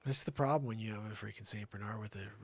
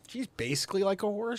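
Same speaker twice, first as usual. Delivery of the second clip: much higher, louder punchline; exaggerated high emphasis; 11 semitones higher, 7 dB louder than usual. To audience about his dog, comic high voice. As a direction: pitch it up to sell the joke. Repeats horse line, mock-high.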